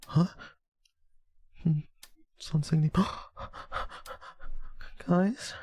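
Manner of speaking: high, hesitant mock fright; high-pitched feigned jitters, half-convincing; pretend scared. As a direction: fake being spooked, small high voice. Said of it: "I don't know how to be scared".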